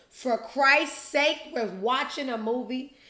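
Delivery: irritated moviegoer telling them off; annoyed shush. Retold or imitated. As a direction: scold them for the noise, drawn out.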